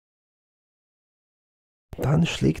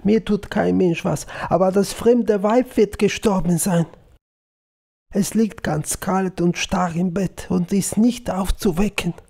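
higher-pitched distressed report; sobbing, acted; 6 semitones higher than usual. A narrator's reading. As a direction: read the upset girl in a raised pitch. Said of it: "schluchzte sie".

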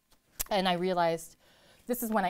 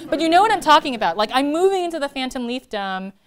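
loud, higher, rushing the words out; excited; 8 semitones higher, 11 dB louder than usual. Real, not acted. Poet to audience, excitedly re-explaining her phrase. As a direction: burst out loud and high, laughing at herself.

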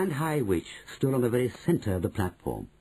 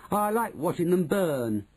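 a hint of a nasty boy, higher and slower; slow, relishing boy's voice, lightly done; lightly voiced gloating boy; 8 semitones higher than usual. A narrator's reading. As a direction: savour it a little, like a cruel child. Boy gloats over burning ants.

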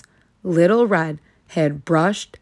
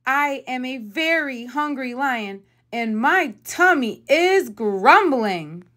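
whiny hungry lion; 6 semitones higher than usual. A narrator's reading. Lion complaining.

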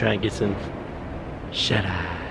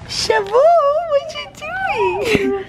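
pet voice; much slower than usual. Real, not acted. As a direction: stretch it out high for the cat. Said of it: "don't judge me on the voice that I use".

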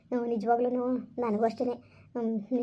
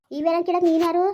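angry shout; 5 semitones higher, 5 dB louder than usual. Acted. Quarrel, sister-in-law shouts back.

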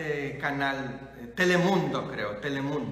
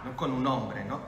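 ordinary talk

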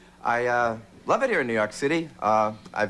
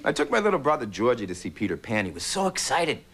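usual speaking voice

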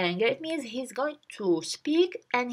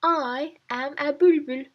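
lightly voiced calm reply; 5 semitones higher, 3 dB louder than usual. Shown as a demonstration. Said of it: "he says".

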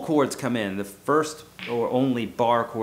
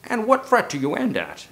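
lightly put-on prim doctor asking, a little higher and louder; 5 semitones higher, 3 dB louder than usual.

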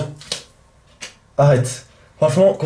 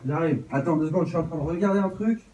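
from another room; 9 dB quieter than usual. Real, not acted.

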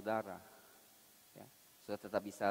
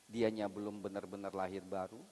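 usual speaking voice

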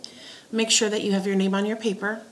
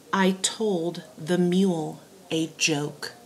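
slow dictation. Shown as a demonstration. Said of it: "give you your three dictation sentences".

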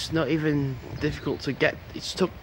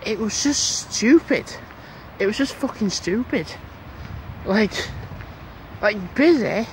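annoyed; 6 semitones higher than usual. Real, not acted. Ranting about crowded McDonald's.

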